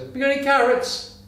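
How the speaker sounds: high voiced rabbit asking for carrots; talking rabbit. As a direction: pipe up high like a tiny rabbit. Joke, rabbit asks again.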